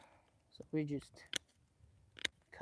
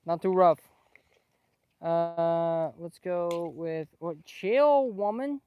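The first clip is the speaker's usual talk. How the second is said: loud scolding; 11 dB louder, much slower than usual. Real, not acted. Scolding the chicken loudly.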